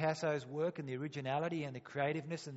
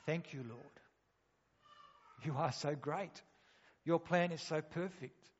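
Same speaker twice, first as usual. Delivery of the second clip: humble thanks. Retold or imitated. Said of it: "humble yourself and say".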